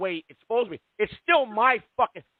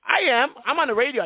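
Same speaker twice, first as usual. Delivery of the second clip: loud defiance; 6 semitones higher, 5 dB louder than usual. Real, not acted. Defiant host shouting over caller.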